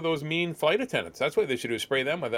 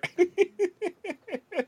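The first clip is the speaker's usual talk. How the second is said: real high giggling laugh; high-pitched laugh. Real, not acted. Comedian cracking up after his chapel punchline, high laughter. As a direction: giggle high in a long run.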